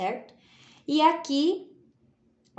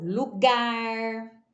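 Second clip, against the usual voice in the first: "lugar" slowly, last syllable held; drawn-out teacher's "lugar"; dictation. Shown as a demonstration. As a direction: say "lugar" as if writing it out. Teacher dictating words while writing.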